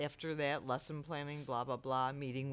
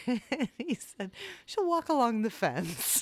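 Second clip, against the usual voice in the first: high, loud, through laughter; laughing retelling; 10 semitones higher, 10 dB louder than usual. Retold or imitated. Dog owner retelling the daycare report, laughing. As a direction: barely get the punchline out for laughing.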